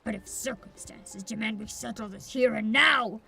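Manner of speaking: low brooding swordsman. Acted. Voices a rival swordsman.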